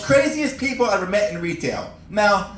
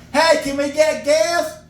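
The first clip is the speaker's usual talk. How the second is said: higher, slow dim customer; 10 semitones higher, slower than usual. Retold or imitated. Customer during the outage asking the clerk for gas, slow and higher. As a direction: drawl it like a confused customer.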